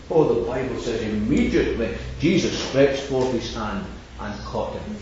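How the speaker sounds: usual speaking voice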